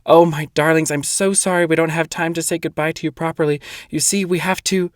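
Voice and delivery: put-on old lady breathless with haste. A narrator's reading. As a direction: rush it out of breath. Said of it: "said breathlessly".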